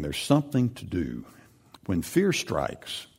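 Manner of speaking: ordinary talk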